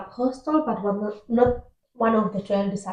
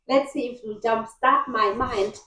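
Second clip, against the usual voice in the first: ordinary talk, nothing marked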